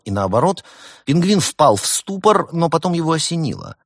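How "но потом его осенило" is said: ordinary delivery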